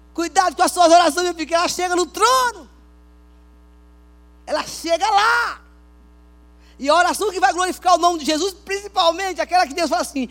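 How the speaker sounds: advertência bradada em tom agudo; pregação inflamada. Real, not acted. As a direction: pregue a plena voz, alongando os finais. (pregação exaltada)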